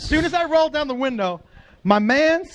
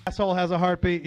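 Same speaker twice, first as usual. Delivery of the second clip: ordinary talk, nothing marked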